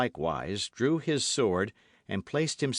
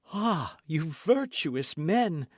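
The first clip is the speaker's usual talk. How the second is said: mocking; 8 semitones higher, much slower than usual. A narrator's reading. Milady mocking her judges.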